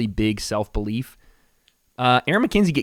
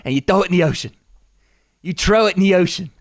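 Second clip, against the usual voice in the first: higher-pitched, muddled Irish-British impression; bad Connor accent; 6 semitones higher, 4 dB louder, faster than usual. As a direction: coach someone in a wobbly mock Irish accent. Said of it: "I don't know what accent I'm doing".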